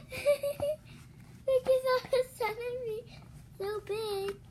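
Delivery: high slow teary laugh; real laughing sob; laugh-crying. Real, not acted. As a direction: laugh through tears.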